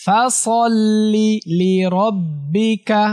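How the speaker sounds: slow melodic chant, long vowels held; slow tajweed recitation drill; Quran recitation. Shown as a demonstration. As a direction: chant each phrase for pupils to repeat. Recites the full verse.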